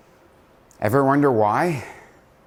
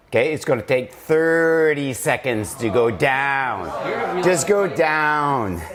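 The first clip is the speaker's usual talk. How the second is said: slow coaching; 3 semitones higher, slower than usual. Shown as a demonstration. Coach to group lowering slowly, drawn-out coaching. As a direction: stretch each word to slow them down.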